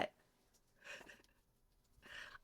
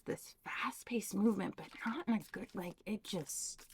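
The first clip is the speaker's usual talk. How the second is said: soft breathy whisper; 8 dB quieter than usual. Real, not acted. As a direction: drop to a hushed whisper. Tarot reading, hushed aside.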